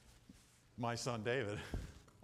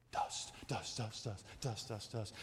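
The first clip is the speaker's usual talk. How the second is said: whispered dust; 10 semitones lower, 7 dB quieter, slower than usual. Pretend. Dust repeated, fading out.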